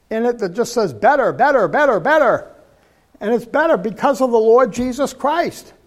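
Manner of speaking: full-force revival preacher chanting better, better; fervent preaching. Acted. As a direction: build the sermon to a ringing climax.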